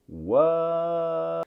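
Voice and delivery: high, drawn-out shock; exaggerated disbelief. YouTuber reacting to Francesca's blunt request, stunned and drawn-out. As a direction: stretch it out high in mock astonishment.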